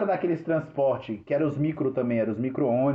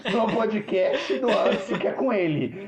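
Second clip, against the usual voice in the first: higher voice breaking into laughter; laughing tease; 6 semitones higher than usual. Real, not acted. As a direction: tease a friend through giggles. Teasing a friend while laughing. (zoando o amigo, rindo)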